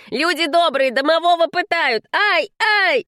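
high-pitched cry for help with yelps; hammed-up victim's howl; theatrical wailing. A narrator's reading. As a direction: wail to passers-by as if tortured. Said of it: "завопил домовой".

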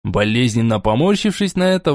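ordinary delivery, nothing marked

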